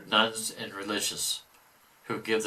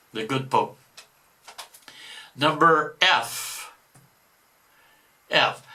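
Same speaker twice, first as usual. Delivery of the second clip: normal talking voice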